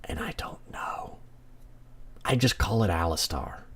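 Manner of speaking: slow, barely voiced whisper; hushed aside. Player admitting not knowing the name, playful whisper. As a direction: whisper it slowly, very softly. Mock-confiding doubt about a name.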